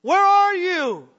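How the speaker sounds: loud, high, drawn-out cry to the sky; slow pleading shout; desperate yell. Acted. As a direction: call out for help across the storm. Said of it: "one guy yell out".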